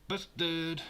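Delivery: high, loud "Busted!"; excited shout. Acted. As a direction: yell it like catching someone out. Mock caught-out exclamation.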